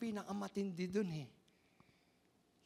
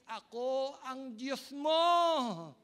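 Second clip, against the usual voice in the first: very slow, loud, high divine proclamation; booming voice of God; 4 semitones higher, 9 dB louder, much slower than usual. Acted. Pastor voicing God's declaration to the faithful, booming. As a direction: declare it as God himself, drawn out. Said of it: "Yun ang ibig sabihin".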